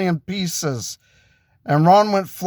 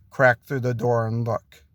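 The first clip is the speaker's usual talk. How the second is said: ordinary delivery, nothing marked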